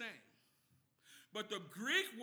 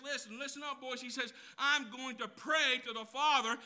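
loud reassurance; 5 semitones higher, 6 dB louder than usual. Acted. Jesus reassuring disciples, preached.

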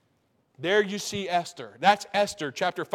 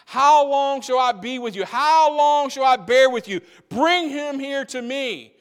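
loud, raised preaching rebuke; voicing Jesus; 5 semitones higher, 5 dB louder than usual. Preacher voicing Jesus rebuking the faithless crowd, loud. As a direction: cry it out like an exasperated teacher.

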